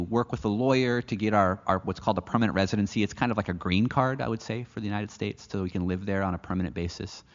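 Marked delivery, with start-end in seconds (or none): none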